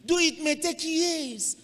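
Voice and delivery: high, loud exhortation; preaching peak. Real, not acted. Preacher reassuring the faithful, high and loud. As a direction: lift the line up and out.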